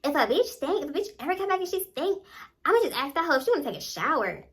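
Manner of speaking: high, fast mock gossip voice; lightly voiced friend gossiping, high and quick; imitating friend. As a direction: mimic her catty advice quickly. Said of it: "telling me".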